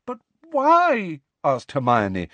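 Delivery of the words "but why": high, louder, pleading, close to crying; near tears. A narrator's reading. Hermione pleading with Hagrid near tears. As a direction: cry out the question, almost in tears. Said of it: "who sounded as though she wanted to cry".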